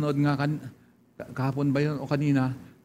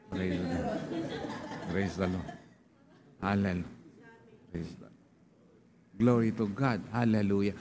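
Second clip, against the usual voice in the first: soft murmured praise; 8 semitones lower, 8 dB quieter than usual. Real, not acted. Pastor murmurs praise aside.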